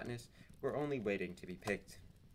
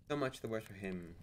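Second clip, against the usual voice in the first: ordinary delivery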